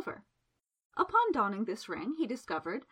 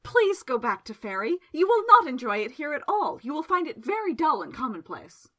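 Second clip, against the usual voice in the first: louder, pleading desperately; a desperate wizard pleading with a shrieking bird; desperate plea; 4 semitones higher, 7 dB louder than usual. A narrator's reading. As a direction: beg the bird to leave, louder and louder. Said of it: "he said desperately".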